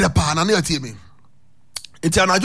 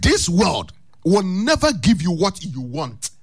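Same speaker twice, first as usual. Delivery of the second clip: slow preaching; 3 semitones lower, slower than usual. Acted. Preacher's emphatic slow maxim.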